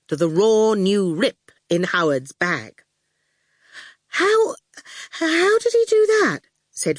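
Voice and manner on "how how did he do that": high, startled student voice; amazed Fifi. A narrator's reading. Student asks about the knife trick, high and amazed. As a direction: gasp it out high.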